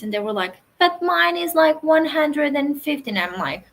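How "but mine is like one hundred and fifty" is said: higher, louder whiny plea; whiny customer. Retold or imitated. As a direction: plead like an entitled buyer. Customer quoted arguing back.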